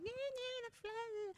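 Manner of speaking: high, soft mocking squeal; freaking-out nervous flyer. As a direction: imitate an annoying panicky passenger, high and small. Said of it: "she was freaking out, really annoying me".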